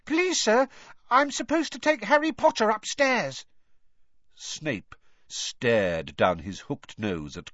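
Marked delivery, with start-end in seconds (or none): 0.1-3.5 put-on high, bright eager boy voice; a keen little kid asking politely; eager young boy. A narrator's reading. Colin voiced by narrator.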